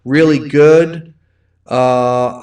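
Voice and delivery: normal talking voice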